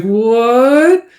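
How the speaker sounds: high, drawn-out rising cry; shocked disbelief. Acted. Director told he's not invited, shocked disbelief. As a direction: stretch it upward in mock dismay.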